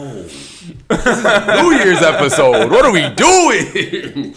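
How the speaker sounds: loud, high, drawn-out laughing wail; laughing disbelief. Real, not acted. Host amazed at their tearful episode, laughing hard. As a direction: cry out slowly through big laughter.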